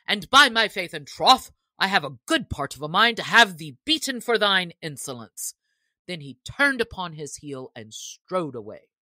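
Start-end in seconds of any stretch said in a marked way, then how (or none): 0.1-5.6 louder angry threat, voice raised. A narrator's reading. Sheriff threatening to beat the insolent archer, raised angry voice. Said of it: "his voice trembled with anger".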